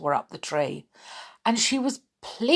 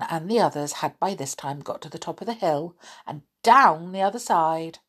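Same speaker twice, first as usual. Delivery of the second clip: ordinary delivery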